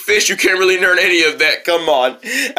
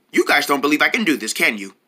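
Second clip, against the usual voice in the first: normal talking voice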